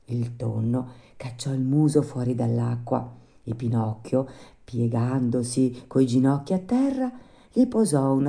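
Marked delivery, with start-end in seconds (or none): none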